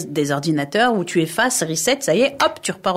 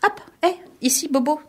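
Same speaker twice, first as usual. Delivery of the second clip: petite voix aiguë et enfantine du foie; voix du foie qui parle; 7 semitones higher, faster than usual. Retold or imitated. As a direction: joue le foie qui se plaint comme un enfant. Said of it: "Et elle me dit". (petite voix aiguë du foie qui réclame)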